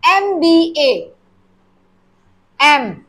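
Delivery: एम बी ए said letter by letter, slowly; pointing out that एम starts with a vowel sound; spelled slowly. Shown as a demonstration. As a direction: spell out एम बी ए, then hold एम. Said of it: "है ये consonant".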